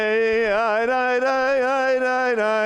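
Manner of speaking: ordinary talk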